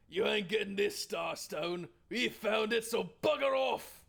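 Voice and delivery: put-on thug voice, rising to a loud finish; angry bandit shout. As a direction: shout intruders away as a hammed-up bandit. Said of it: "angry shouts of bandits".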